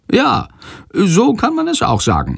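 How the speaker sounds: high, lively storyteller figure; chatty Sándor, obliging. A narrator's reading. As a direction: recount it eagerly in a high character voice. Sándor answers and continues. (hoch und bereitwillig erzählend)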